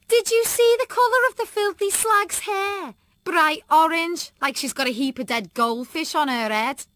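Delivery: high, loud cruel jeering; mean girl Kim. A narrator's reading. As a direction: laugh at her hair, nasty. Voices Kim mocking.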